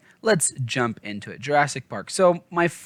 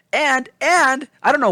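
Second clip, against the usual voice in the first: excited; 13 semitones higher, 7 dB louder than usual. Real, not acted. Excited about book detail.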